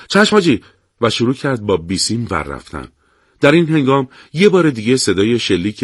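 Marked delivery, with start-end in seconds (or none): 0.1-0.6 much higher quick reply; hurried. A narrator's reading. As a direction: rush the answer, eager to obey. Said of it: "شتاب زده گفت".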